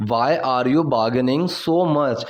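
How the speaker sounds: slow model sentence. Shown as a demonstration. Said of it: "repeated model, slower still".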